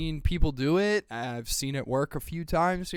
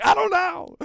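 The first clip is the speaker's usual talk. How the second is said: clueless; 6 dB louder than usual. Retold or imitated. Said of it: "I was like".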